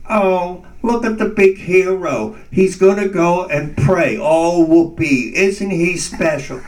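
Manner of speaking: high, sneering mockery; mocking inner voice. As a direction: taunt him like a sarcastic critic inside his head. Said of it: "I hear inside of me".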